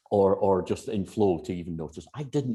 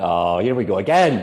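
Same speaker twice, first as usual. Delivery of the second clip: louder, weary here-we-go-again; mock exasperation at another hypnotherapy story; exasperated; 8 dB louder than usual. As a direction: roll your eyes at it out loud. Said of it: "I went".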